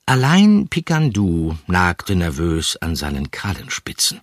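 ordinary delivery, nothing marked

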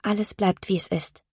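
hushed, forced brave comfort; soft reassuring. Acted. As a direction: comfort her brother softly. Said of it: "schluckt seine Angst herunter".

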